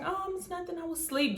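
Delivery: high, hushed mock-innocent voice; mock innocent girl. The other girl faking sleepiness on the call, high and hushed. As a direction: play dumb, all sweet and quiet.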